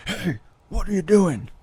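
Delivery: high, sharp call across the hall; stern shout. Retold or imitated. Priest shouting across the church at the boy, stern shout. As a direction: catch the kid red-handed.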